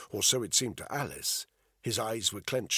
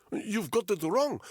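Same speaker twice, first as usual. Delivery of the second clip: high, desperate Russian prisoner; high-pitched frantic denial; panicked protest; 12 semitones higher, 3 dB louder than usual. Acted. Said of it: "he said in Russian".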